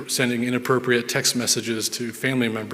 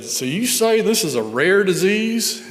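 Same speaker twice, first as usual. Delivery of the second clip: thick Southern drawl, higher and slow; southern accent; 8 semitones higher, 4 dB louder, slower than usual. Retold or imitated. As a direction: put on a strong Southern accent. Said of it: "in the most southern accent possible".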